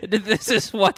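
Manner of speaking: ordinary talk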